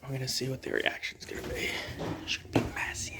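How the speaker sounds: soft excited whisper. Real, not acted. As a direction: keep it hushed, a secret. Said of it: "I'm whispering 'cause it's a secret".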